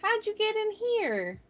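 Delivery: high-pitched, puzzled little protest; mock-surprised scolding. Real, not acted. Streamer to a stray Twitch timer, high mock surprise. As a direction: ask it high and baffled, rather softly.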